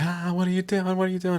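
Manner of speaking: high, loud, frantic critter voice; squeaky panicked animal voice. Retold or imitated. Animal being petted, high panicked squeak. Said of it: "it's like".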